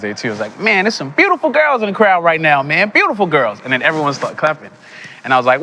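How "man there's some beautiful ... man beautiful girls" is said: high, louder put-on American comic accent. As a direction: work the crowd in an American accent. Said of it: "talking in an American accent".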